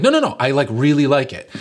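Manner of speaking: high, loud flustered protest; frantic insisting. Retold or imitated. Reassuring the gift giver, high and flustered. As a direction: protest too eagerly. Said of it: "And I'm like".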